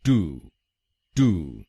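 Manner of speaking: टू said as an American "too"; American syllable. Shown as a demonstration. Teacher contrasting the American third syllable. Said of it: "American pronunciation में इसको बोलेंगे टू".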